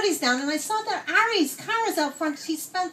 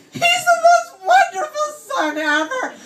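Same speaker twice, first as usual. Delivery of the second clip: high, hammed-up wailing; mock crying; 9 semitones higher, 4 dB louder than usual. Woman defending her absent son, mock crying. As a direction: wail it in a high, tearful mock sob. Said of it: "And she starts crying".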